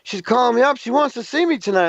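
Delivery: a friend at the gas station bragging; excited coworker. Retold or imitated. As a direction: brag about the girl who called. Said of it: "He says".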